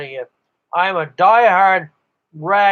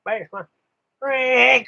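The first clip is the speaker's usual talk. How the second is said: imitating operator; 5 semitones higher than usual. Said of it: "You know, it's, uh".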